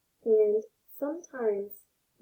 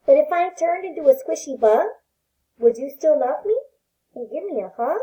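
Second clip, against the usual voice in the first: gentle read-aloud voice for little ones; storybook reading; 4 dB louder than usual. Acted. Teacher reading a picture book to her pre-K class, gentle storytelling.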